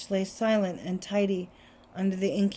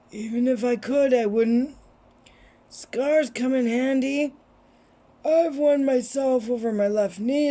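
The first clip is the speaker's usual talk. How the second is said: put-on wise old wizard voice; 3 semitones higher, 3 dB louder than usual. Reads wizard headmaster's lines.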